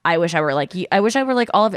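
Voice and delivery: ordinary talk, nothing marked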